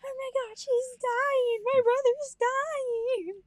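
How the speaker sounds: high, frantic panic; panicked. Retold or imitated. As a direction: shriek it in a frightened panic. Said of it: "she's like".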